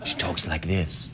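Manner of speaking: low, hushed bass impression; big deep voice. Host imitating his wife's voice, big and deep. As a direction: drop right down, deep and quiet. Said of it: "A big, deep voice".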